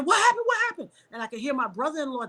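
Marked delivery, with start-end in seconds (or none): 0.0-0.9 high-pitched mock scream, held back; urgent, high retold shriek at moderate volume. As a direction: imitate a panicked sister's cry, much higher. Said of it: "She started screaming".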